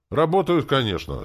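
loud, high first word, then easing; grudging, emphatic reply; condescending. A narrator's reading. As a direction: grant the answer with a show of exasperation. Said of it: "снизошёл".

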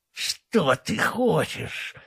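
slow, hoarse rasping ghoul voice; hoarse wheeze. A narrator's reading. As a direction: drag it out in a breathy wheeze. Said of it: "прохрипел вурдалак". (хриплый медленный шёпот вурдалака)

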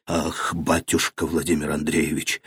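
put-on soft, out of breath old man; breathless old man. A narrator's reading. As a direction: gasp it out like an old servant. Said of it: "отвечал старик, задыхаясь".

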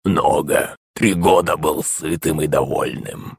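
soft, slow bubbling creature; gurgling monster voice. A narrator's reading. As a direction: gurgle it out, sated and smug. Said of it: "пробулькал Водяной".

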